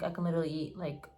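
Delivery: hushed confiding whisper. Real, not acted. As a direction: whisper it like a secret. Confiding love of onions.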